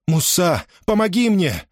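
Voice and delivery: high urgent plea; pleading cry, pitched high; desperate call for help. A narrator's reading. Mother calls son for help.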